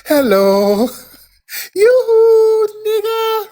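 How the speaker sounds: very high, light cooing voice; demure yoo-hoo. Acted. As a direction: coo it out, sweet and high. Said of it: "They're real demure".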